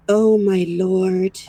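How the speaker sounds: ordinary talk, nothing marked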